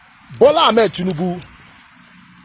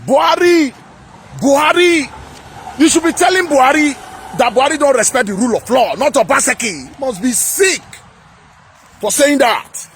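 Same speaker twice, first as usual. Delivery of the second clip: raised, fast, higher heated rant; heated shouting; 5 semitones higher than usual. Real, not acted. Angry vlogger calling out Buhari over Tinubu's words, shouting. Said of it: "Sorry, I'm shouting".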